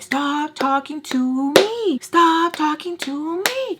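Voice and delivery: loud, high, drawn-out demand; stretched yell of exasperation; mad outburst. Retold or imitated. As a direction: clap out each word slowly, furious. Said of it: "I'm getting mad and I'm clapping".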